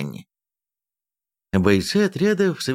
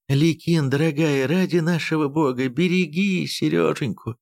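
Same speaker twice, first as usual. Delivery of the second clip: weak moaning plea; 6 semitones higher than usual. A narrator's reading. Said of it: "Простонала Евдокия тяжело и часто дыша".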